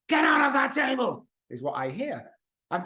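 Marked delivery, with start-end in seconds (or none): 0.1-1.3 much higher, louder, yelled as a frightening command; harsh shout as heard. Retold or imitated. What the child's alcoholic brain hears from mom, a frightening yell. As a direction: blast it like a scary parent's yell.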